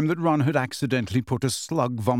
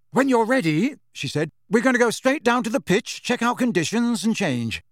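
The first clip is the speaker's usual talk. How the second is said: brisk Angelina; 9 semitones higher than usual. A narrator's reading.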